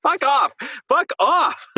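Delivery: exasperated dismissal. Real, not acted. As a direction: brush it away in disbelief. Mocking the trailer song.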